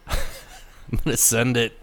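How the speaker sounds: slow, drawn-out bro voice; frat bro. Pretend. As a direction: draw it out like a dumb bro. Mocking bro catchphrase.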